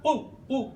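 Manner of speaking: उ, उ as a short clipped u; short u sound. Shown as a demonstration. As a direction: isolate the vowel उ, quick and short. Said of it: "तो ये जो उल है ना".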